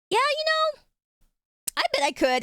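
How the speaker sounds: put-on high bragging child-self; cocky kid boast. Tomboy taunting the boy at camp, high cocky boast. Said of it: "And I was like".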